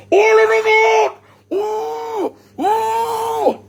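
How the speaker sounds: high, loud mock shriek of shock; hammed-up squeal. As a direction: shriek like hearing something outrageous. Acting out shocked reaction.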